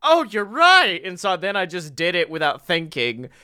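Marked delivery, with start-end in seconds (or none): 0.0-1.0 high-pitched retold epiphany; sudden realisation. Student suddenly agreeing with coach, imitated high-pitched realisation. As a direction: burst out with mock-amazed agreement.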